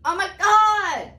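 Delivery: high, loud, drawn-out oh my god; excited squeal. Real, not acted. Vlogger thrilled her glitter spray works, squealing. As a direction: squeal it with delight.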